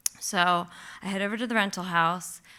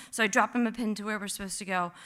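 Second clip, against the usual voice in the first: usual speaking voice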